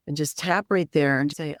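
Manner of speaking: normal talking voice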